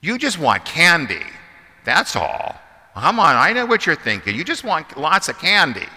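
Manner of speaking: loud teasing accusation; playful teasing. Pretend. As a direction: call them out with a grin. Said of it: "my first response is going to be".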